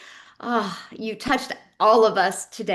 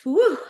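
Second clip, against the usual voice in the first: short, high, loud "woo"; emotional woo; 9 semitones higher, 6 dB louder than usual. Real, not acted. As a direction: let out a quick high whoop of release. Host reacts to tearful story.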